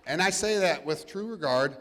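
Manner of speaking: ordinary talk, nothing marked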